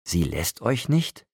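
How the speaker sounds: sarcastic echo thrown back at Edward. A narrator's reading. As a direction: drag it out mockingly. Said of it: "wie ätzend mein Sarkasmus war".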